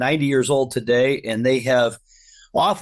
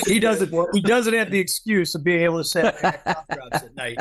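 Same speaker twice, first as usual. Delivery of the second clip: laughing; 5 semitones higher than usual. Real, not acted. Laughing at golf course joke.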